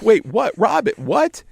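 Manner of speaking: high, loud disbelief; shocked. Retold or imitated. [name] reacting to Robin in high, loud disbelief. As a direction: snap back in alarm. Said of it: "And I'm like".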